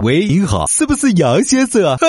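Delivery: high, giggly embarrassed caller; sheepish scammer voice. Acted. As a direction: play the rookie scammer laughing it off.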